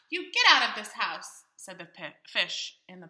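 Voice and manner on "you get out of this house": angry order. Acted. Fish orders cat out.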